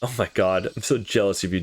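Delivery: ordinary talk, nothing marked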